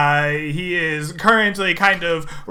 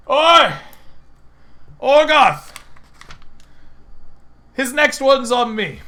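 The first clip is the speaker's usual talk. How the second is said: loud, higher call across the bar; 9 semitones higher, 4 dB louder than usual. Acted. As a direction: hail the barman from down the counter. Patron calls out to barman.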